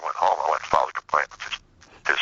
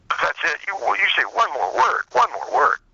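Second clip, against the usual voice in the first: mock angry judge; 12 semitones higher than usual. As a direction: ham up the furious judge cutting him off. Retelling the furious judge.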